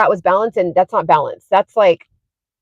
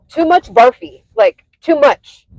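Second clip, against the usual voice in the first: light Indian accent, imitating dad; a dad's Indian accent, slowed; 6 semitones higher, 4 dB louder, slower than usual. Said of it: "like, in his Indian accent".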